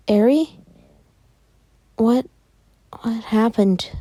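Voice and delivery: high, halting question; questioning sister. Acted. Izuka checks on Eri once the glow stops, high and halting.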